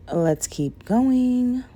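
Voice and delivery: ordinary delivery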